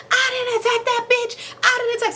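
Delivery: high squealing mock voice, protesting; mock hysterical denial. Mama on the floor protesting to police, high mock wail. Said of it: "The mama's on the floor like".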